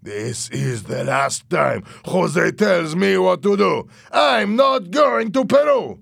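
slow, loud, rising to a high yell; defiant shouting, words stretched out; angry refusal. A narrator's reading. Louis refuses in a heated quarrel.

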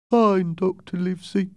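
high, put-on sailor voice; sick sailor. George answering the doctor, high-voiced.

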